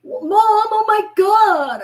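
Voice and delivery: high, loud teasing disbelief; mock the mom for dating old; teasing kids mocking mom's senior dating site. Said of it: "my kids were making fun of me".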